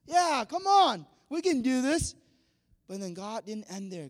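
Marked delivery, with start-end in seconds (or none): 0.1-2.1 higher, louder eager cheer; rally like a fan; eager Moses. Retold or imitated. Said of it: "Moses would be like".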